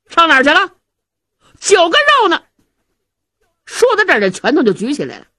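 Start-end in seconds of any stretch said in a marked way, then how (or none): 0.1-2.4 loud, high furious yell at the servant; angry shout. Acted. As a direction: bark it out like a starving hothead. Hungry Zhao Hu yells at servant. (高声怒喝)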